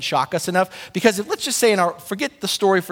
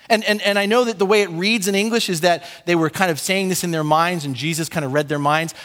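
usual speaking voice

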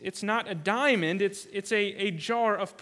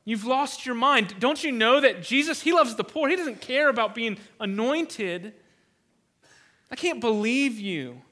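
acted scolding, building louder then easing; 4 dB louder than usual. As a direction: berate her as an outraged onlooker would. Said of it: "And then they scolded her".

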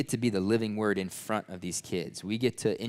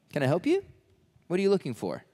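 higher-pitched, puzzled asking; casual questioning; 6 semitones higher, faster than usual. Retold or imitated. Jesus turning to people behind him, casually questioning. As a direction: ask it lightly in a raised pitch.

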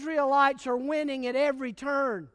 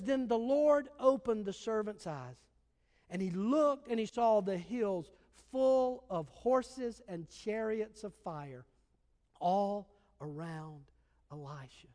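scripture reading; 4 semitones lower, 5 dB quieter than usual. Acted. Reads the scripture verse.

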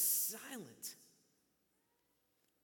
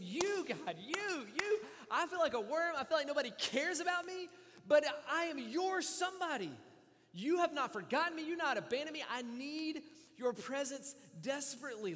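loud, high, pleading cry to God; impassioned preaching; 7 semitones higher, 8 dB louder than usual. Acted. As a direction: preach it full force, begging God. Preaching the psalm at full force.

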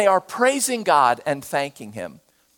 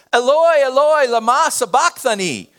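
loud, higher anguished cry; a loud desperate call upward; 7 semitones higher, 5 dB louder than usual. A narrator's reading. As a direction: cry it out to God in agony. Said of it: "Jesus cried out with a loud voice".

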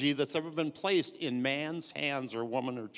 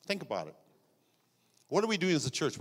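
normal talking voice

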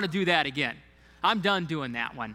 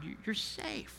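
much softer and higher, hushed reassurance; soft whisper from God; 5 semitones higher, 11 dB quieter than usual. Pretend. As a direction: breathe it gently as God's quiet voice. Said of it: "you hear those whispers from God".

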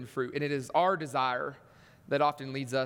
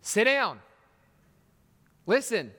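loud, raised order, each command on its own; forceful acted command, pitched up; commanding God; 6 semitones higher, 5 dB louder, slower than usual. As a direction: stop them in their tracks. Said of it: "God looks at you and I and he says".

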